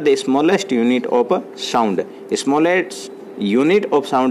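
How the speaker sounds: very slow, word by word English; dictation pace. Shown as a demonstration. Teacher dictating a definition to students, very slow. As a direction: read it out slowly so they can write. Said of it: "dictating a definition".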